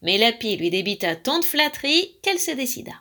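ordinary delivery, nothing marked

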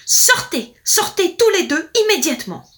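high, loud enraged orders; furious shout. A narrator's reading. Lord throws the couple out, furious. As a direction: explode and order them out. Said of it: "hors de lui".

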